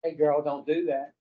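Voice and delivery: low, softly spoken warning; softly caution, like a thought from within; little inner voice. Acted. Inner voice warning someone each time, low and soft. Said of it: "every time you heard that voice say".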